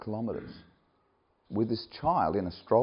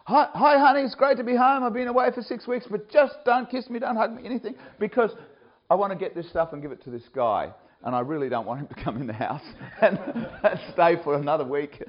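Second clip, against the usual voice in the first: high, loud, hurried mock greeting to his wife; laughing hammed-up hello to the wife; excited homecoming; 8 semitones higher, 5 dB louder than usual. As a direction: burst in laughing, hands off. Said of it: "running into my house like".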